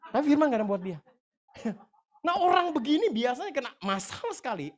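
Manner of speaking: loud, high-pitched warning from the pulpit; preaching at full force. Real, not acted. (khotbah berapi-api, suara lantang dan tinggi)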